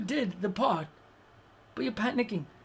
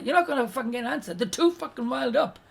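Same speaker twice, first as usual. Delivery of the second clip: ordinary talk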